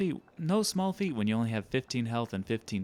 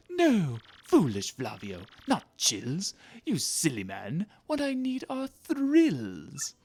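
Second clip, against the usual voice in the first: pompous musing bursting into theatrical revelation; excitable rich trader; 3 dB louder than usual. Acted. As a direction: play a grandiose trader swept away by the idea.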